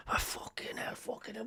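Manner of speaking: very quiet frustrated muttering; hushed mock grumbling; Big Davey. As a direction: curse under your breath as a flustered caller. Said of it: "he kept getting frustrated".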